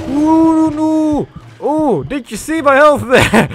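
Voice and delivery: high, loud yelp of panic; real high-pitched shock; alarmed shriek. Real, not acted. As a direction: shriek at a sudden near-death scare. Sudden near death in the game.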